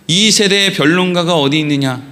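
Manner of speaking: low, even verse recital; composed Bible reading in a low pitch; scripture reading. Acted. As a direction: read it formally, falling at the end. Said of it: "그리고 또 이어서는 이렇게 돼 있죠".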